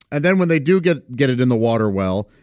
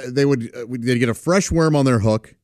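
ordinary talk, nothing marked

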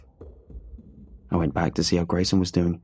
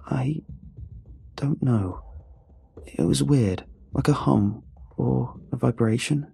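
halting and higher, with long pauses; hesitant; 5 semitones higher, slower than usual. A narrator's reading. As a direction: feel unsure for the words, haltingly. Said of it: "He hesitated".

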